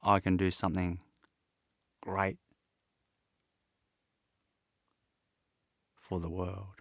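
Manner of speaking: soft and low, with long pauses; real emotion, halting and hushed; choked with emotion. Real, not acted. Man moved by recalling his youthful faith in hardship, emotional and quiet. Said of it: "Sorry, I'm getting emotional".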